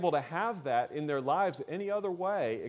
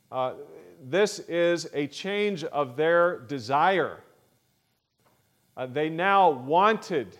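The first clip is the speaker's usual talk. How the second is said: forceful preaching; 3 semitones higher, 7 dB louder than usual. Acted. Preacher stresses a key word.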